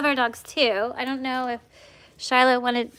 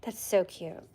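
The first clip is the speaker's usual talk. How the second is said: ordinary delivery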